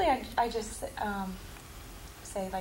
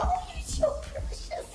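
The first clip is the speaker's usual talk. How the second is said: put-on high, slow, breathy baby talk; brother's baby talk; much slower than usual. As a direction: coo like a big brother to a baby. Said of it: "He talks like".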